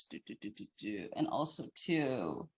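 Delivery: very soft, low placeholder muttering; absent-minded mumbling. Real, not acted. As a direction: mumble under your breath while hunting for the thought. Filling time, losing train of thought.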